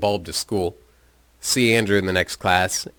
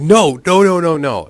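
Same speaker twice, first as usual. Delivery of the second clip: much higher, much louder panicked no's; frantic refusal; 10 semitones higher, 12 dB louder than usual. Retold or imitated. Square friend yelping panicked no's at the plan. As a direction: yelp it like a scared kid refusing.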